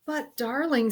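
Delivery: a father gently pleading; king's indulgent plea. Acted.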